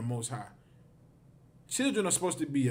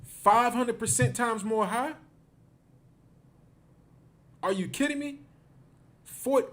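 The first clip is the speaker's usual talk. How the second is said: are you kidding me, much higher; stunned disbelief; 8 semitones higher than usual. Real, not acted. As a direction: pitch shooting up in shock. Outraged at statistic.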